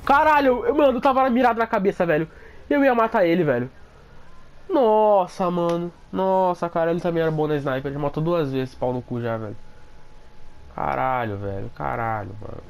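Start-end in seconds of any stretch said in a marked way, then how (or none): 0.0-3.7 loud, high frustrated outburst; shocked yelling. Real, not acted. Sniped after aiming at head. 4.7-7.9 panicking gamer. Real, not acted. Just killed by sniper.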